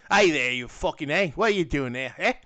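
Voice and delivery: imitating a rough Australian accent; Captain Boomerang's accent. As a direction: put on a jokey Aussie accent. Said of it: "his natural accent".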